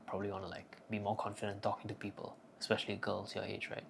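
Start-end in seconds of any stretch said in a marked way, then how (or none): none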